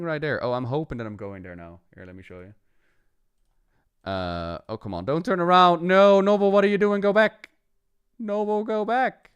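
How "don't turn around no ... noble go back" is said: high, loud panicked shouts of "go back"; frantic yell at character. Real, not acted. Streamer yelling at his paladin Noble to go back, frantic.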